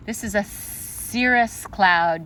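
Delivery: usual speaking voice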